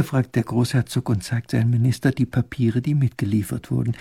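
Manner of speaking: ordinary delivery, nothing marked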